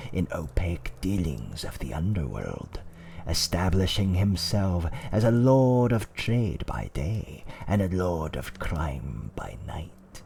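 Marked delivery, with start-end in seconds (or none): none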